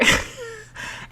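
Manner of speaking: short, high embarrassed giggle. Real, not acted. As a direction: giggle at how it sounds. Laughs at herself.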